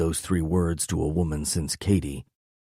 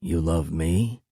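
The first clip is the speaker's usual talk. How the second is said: plain reading voice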